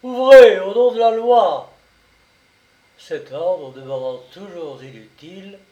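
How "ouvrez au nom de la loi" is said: loud, high police order; commanding shout. A narrator's reading. Brigadier ordering the door opened, shouting. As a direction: bellow it at a closed door. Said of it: "il cria".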